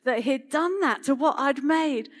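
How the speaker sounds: put-on reliving her anger at the toddler; mother recalling her ruined Lego castle, mock furious; retold fury. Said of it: "she said".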